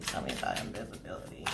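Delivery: soft unvoiced whisper to Spirit. Real, not acted. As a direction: breathe the question to Spirit in a whisper.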